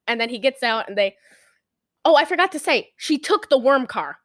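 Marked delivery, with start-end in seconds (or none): none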